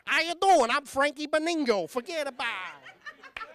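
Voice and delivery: high, hammed-up New York wise-guy accent; mafia kid voice. Comic imagining his son introducing himself in a New York mafia accent. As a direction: play it like a tiny mob boss. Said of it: "most mafia-sounding kid in the world".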